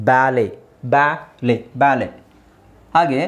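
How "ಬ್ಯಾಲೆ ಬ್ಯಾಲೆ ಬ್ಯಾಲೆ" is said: "ಬ್ಯಾಲೆ" with "bae" vowel, then open "lay"; silent T. Shown as a demonstration. Teacher correcting baale to ballet, silent T. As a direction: repeat "ಬ್ಯಾಲೆ" clearly, once split in syllables. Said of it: "ಬಾಲೆ ಅಲ್ಲ".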